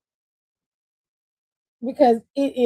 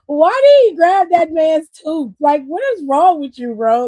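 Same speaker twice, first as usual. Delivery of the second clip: exasperated outburst; 4 semitones higher, 7 dB louder than usual. Real, not acted. Ranting about the tooth grab.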